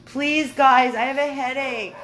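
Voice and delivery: loud exasperated plea to barking dogs. Real, not acted. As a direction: beg the dogs to stop.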